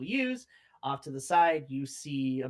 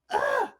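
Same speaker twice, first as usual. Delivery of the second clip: loud, high fed-up groan; exasperated high groan; 8 dB louder than usual. Acted. Boy groaning at the squeak, loud and fed up. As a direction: groan out in frustration.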